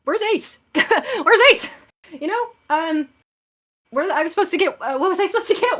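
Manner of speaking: high, loud flustered chatter; flustered babble. Acted. Embarrassed friend babbling before running off, high and flustered. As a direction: cover your slip, scrambling.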